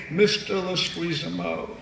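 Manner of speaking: slow, soft, put-on teacher voice; imitated teacher addressing a pupil; math teacher. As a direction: draw out the student's name like an old-school teacher. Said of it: "my math teacher in particular".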